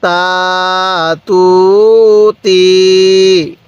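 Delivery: very slow, each vowel stretched long; drawn-out syllables. Shown as a demonstration. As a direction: stretch সা সু সি for a learner. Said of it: "হ্যাঁ, পড়ুন।". (টেনে টেনে ধীরে উচ্চারণ)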